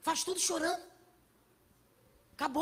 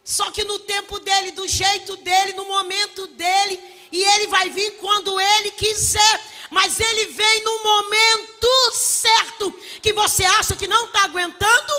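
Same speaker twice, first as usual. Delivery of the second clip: loud, shouted preaching over music; full-force preaching; 4 semitones higher, 13 dB louder than usual. Acted.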